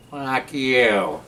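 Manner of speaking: squawky drawn-out parrot imitation; cussing parrot. Parrot talking back to its owner, bird voice. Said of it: "the bird looks at him".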